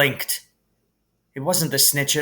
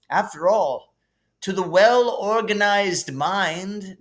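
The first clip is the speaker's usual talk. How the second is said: put-on kindly old headmaster; 7 semitones higher, 3 dB louder than usual. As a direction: share a gentle lesson about death like a wise old wizard. Narrator voices Dumbledore.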